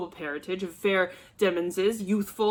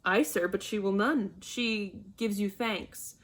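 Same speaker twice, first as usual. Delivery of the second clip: ordinary talk, nothing marked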